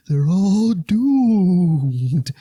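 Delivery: slow, ominous prophet voice, loud and high; Crazy Ralph. Retold or imitated. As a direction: boom out a doom warning. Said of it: "That's actually Crazy Ralph".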